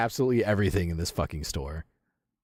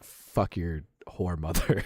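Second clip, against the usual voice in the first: slow translation; 7 semitones lower, slower than usual. Shown as a demonstration. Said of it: "It means, like".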